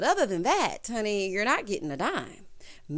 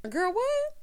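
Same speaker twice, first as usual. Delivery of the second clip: incredulous. Retold or imitated. Recapper's incredulous retort.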